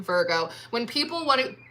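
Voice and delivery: normal talking voice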